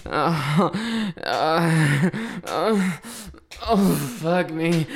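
ordinary talk

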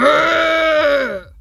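loud guttural growl. Acted. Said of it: "I'm scaring them".

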